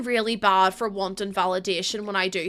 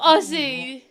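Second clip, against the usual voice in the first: ordinary delivery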